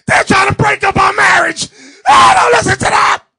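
high, full-volume mock outcry; shouted wife impression. Wife protesting to her husband about their marriage, high shouting. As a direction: scream it out high as the scheming wife.

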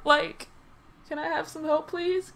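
high, soft pleading ask. Real, not acted. Gamer begging the world for teammates, high and pleading. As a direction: beg sweetly for help in a small high voice. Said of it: "I need help".

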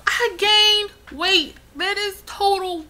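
high, loud, slow furious complaint; outraged rant. Real, not acted. Vlogger ranting at the weight gain, high loud outrage. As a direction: spit out the injustice of the scale.

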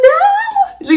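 very high drawn-out squealed refusal; retold frantic cry. Woman reliving her refusal to give up the car, frantic. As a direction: re-enact a desperate, piercing protest. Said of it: "losing my mind".

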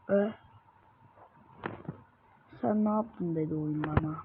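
ordinary talk

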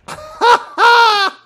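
high, loud cackling laugh; shrieking belly laugh; loud laughter. Real, not acted. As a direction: burst out laughing at full volume. Cracking up at two Georges.

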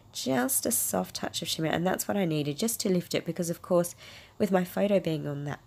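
ordinary delivery

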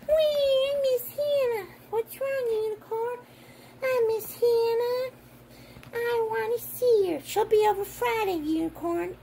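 high, softened pretend whimper; crying toy unicorn. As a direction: play a sad toy and its comforter, pitched high. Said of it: "Unicorn go".

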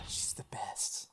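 soft delighted whisper. Real, not acted. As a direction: breathe it out in awe.

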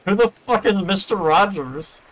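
high, slow disbelieving question; incredulous. Real, not acted. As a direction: ask in baffled disbelief. Baffled outburst at a name.

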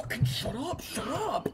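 mock crying yell. Teasing his friend who hid crying in a locker, mock tearful yell. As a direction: whine it like a kid crying in a locker. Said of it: "crying in a locker, going".

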